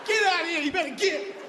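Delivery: high, scolding shoo; shooing yell. Acted. Shooing people off like cats, high scolding yell.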